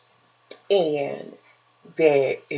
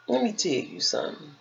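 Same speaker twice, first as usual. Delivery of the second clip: normal talking voice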